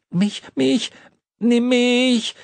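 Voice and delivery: high, loud whiny nagging; pestering child. A narrator's reading. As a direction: whine like a spoilt brat. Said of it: "quengelte er".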